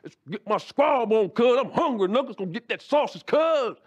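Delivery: hammed-up gangbanger demanding his sausage. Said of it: "he starts going through the whole gangbanger".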